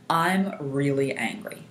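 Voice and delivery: slow, measured statement, word by word; stating the problem. Shown as a demonstration. Coach leading tapping on the eyebrow point, slow deliberate statement. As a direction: state it deliberately for others to echo. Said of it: "and then again".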